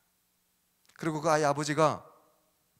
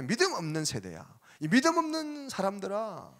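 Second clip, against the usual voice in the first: rising reproach, louder at the end; Jesus rebuking; 7 semitones higher, 4 dB louder than usual. Acted. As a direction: scold the faithless disciples. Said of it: "이렇게 탓하고 있어요". (제자들을 꾸짖는 예수님의 책망하는 목소리)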